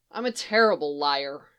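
low, glum admission. A narrator's reading. Barry confessing to the Firebird, glum. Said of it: "he says glumly".

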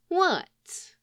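high, mock-innocent query; feigned innocence. A narrator's reading. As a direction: play dumb, all innocence. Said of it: "Len said innocently".

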